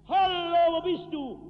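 laut und hoch gerufen, wie über die weite Prärie; ferner Suchruf. Retold or imitated. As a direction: ruf hinaus, als suchst du jemanden in der Ferne. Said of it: "mit ihren Sprachrohren, mit denen sie brüllten". (lauter, hoher Suchruf über die Prärie)

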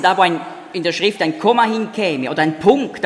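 usual speaking voice

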